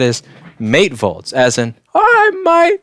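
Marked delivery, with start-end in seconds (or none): 1.9-2.8 high, loud mock Aussie cry; hammed-up Australian accent, pitched way up; bad Australian accent. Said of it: "I can't do an Australian accent".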